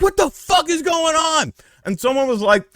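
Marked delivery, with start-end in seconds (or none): 0.0-1.5 high loud shout of disbelief; freaked-out yell. Retold or imitated. Will freaking out at the burning kid, high shout. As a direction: yell it like you just saw a fire. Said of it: "Will is, like, freaky".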